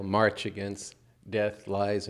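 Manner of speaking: normal talking voice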